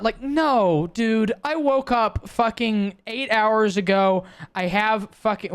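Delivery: high, drawn-out indignant rant; exasperated. Acted. Tired student fending off campus recruiters, exasperated.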